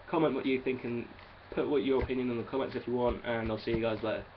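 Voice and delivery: normal talking voice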